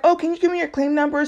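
light imitation of a cheerful agent; super friendly. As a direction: sound bright and helpful on the phone. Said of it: "Super friendly".

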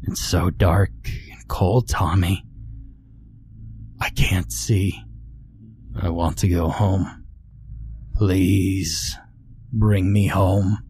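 drawn-out, mournful ghostly plea; slow raspy ghost. A narrator's reading. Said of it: "The voice was light and raspy".

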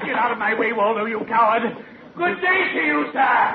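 high-pitched fluster; panicked escape. Acted. Accomplice flees the angry sailor past Waldo, high-pitched panic. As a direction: squeal it while scrambling for the exit.

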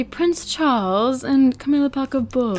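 playful attempt at an English accent. Shown as a demonstration. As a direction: announce the names in a mock English accent. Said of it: "say their names in, in an English accent".

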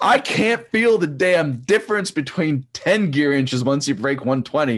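high, loud and slow, throwing up his hands; amused exasperation. Real, not acted. Coach joking to the host about tiny gear differences, high amused exasperation. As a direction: rant it up high in mock disbelief.

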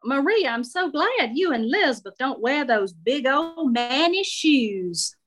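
sweetly nosy Southern belle; Shirley approving Marie's shoes, Southern lady voice. Acted.

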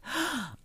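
sharp high gasp of horror; a mock shocked gasp; pearl-clutching gasp. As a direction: gasp like a scandalised old lady. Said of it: "clutches his pearls".

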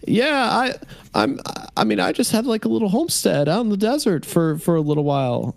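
very high piping voice; piping homesteader. Acted. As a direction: pipe up in a thin high voice. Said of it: "one of the other men kind of pipes up".

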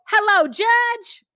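high, loud, slow sing-song greeting; sugary greeting. Retold or imitated. Host imitating the girlfriend greeting the judge, high and sing-song. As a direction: sweetly greet him like an old friend. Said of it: "the way that she says judge".

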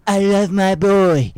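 slow, drawn-out Trump voice; drawled Trump, words stretched; Donald Trump impression. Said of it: "that's a perfect Donald Trump".

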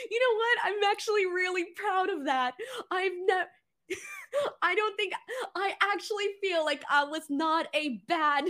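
giggling, delighted at himself; real laughter spilling through the words; laughing giddily. Real, not acted. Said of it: "that was the first time I've ever done that, and I surprised myself".

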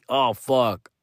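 hushed, low, hopeless mock despair; desperate friend. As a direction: fade into the friend's quiet, sinking panic. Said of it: "he seemed very desesperado".

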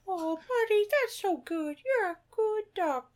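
high, softened parrot mimicry. Co-host joining in as parrot, high soft voice. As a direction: coo it in a small high bird voice. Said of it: "you hear the parrot go".

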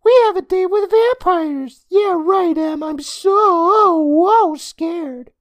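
very high, loud mocking kid; squeaky, hammed-up boyish taunt; teasing. A narrator's reading. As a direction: tease her with drawn-out fake fear. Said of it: "He teased".

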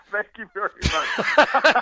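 high, slow, through laughter; cracking up as you thank them; laughing. Real, not acted. Player teased about his botched accent, laughing.

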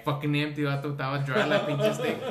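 loud, high disbelief at the empty dipstick; real shock, half laughing; shocked outburst. Real, not acted. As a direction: blurt it out, stunned and loud. Finding the oil dipstick dry.

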